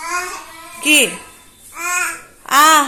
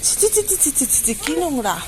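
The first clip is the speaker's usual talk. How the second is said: 1 mock disgust; 8 dB quieter than usual. Real, not acted. Mock disgust at unbrushed child.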